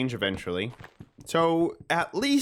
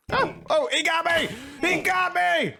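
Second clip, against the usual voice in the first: yelling while running away. Pretend. Caught by skeleton.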